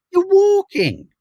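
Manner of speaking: very high, loud mock exclamation; high-pitched heckle at a distracted pedestrian. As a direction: call it out in a high, exaggerated voice. Mimicking exchange with oblivious phone walkers.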